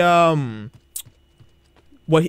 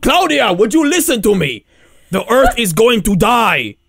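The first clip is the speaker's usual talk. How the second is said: raised, insistent mock frustration; exasperated pleading; 4 semitones higher, 4 dB louder than usual. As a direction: demand to be listened to, hammed-up. Ezio frustrated at being ignored.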